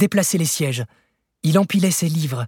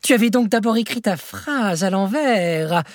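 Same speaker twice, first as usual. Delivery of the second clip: triumphant revelation; 7 semitones higher than usual. A narrator's reading.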